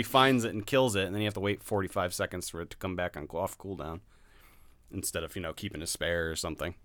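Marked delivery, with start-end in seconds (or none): none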